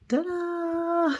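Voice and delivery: high sing-song ta-da; big reveal. Real, not acted. Revealing the crocheted Queen to viewers, high sing-song. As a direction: present it like a magic trick. Presenting the finished doll.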